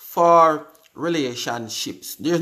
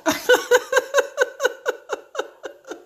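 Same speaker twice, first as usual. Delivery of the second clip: high burst of real laughter. Real, not acted. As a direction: crack up laughing. Laughing at Marika's comment.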